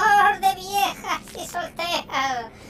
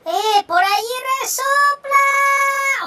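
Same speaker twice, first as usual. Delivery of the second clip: lookout call; 7 semitones higher, 5 dB louder, much slower than usual. Acted. Lookout cry on pirate ride.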